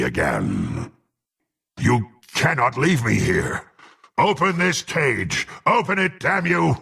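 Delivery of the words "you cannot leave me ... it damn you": urgent, higher-pitched demand; angry demand. Acted. Caged prisoner orders them to open up, angry. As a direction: demand release, pitch climbing as you go.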